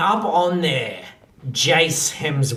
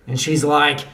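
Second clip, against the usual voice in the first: ordinary talk, nothing marked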